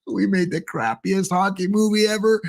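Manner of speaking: hammed-up high laughing boast; mock laughing filmmakers.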